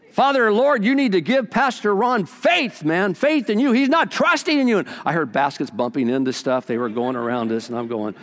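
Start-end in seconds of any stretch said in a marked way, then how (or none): 0.1-4.8 a hint of a loud shouting prayer, higher; mildly hammed-up loud prayer; loud praying. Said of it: "one of those shouters".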